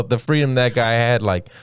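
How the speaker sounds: ordinary talk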